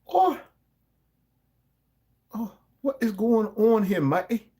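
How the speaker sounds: sudden high startled oh; surprised. Real, not acted. Host startled by something on screen, surprised. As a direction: caught off guard, voice jumping up.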